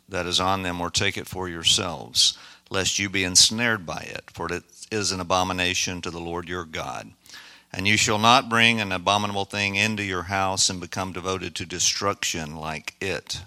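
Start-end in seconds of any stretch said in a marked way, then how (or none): none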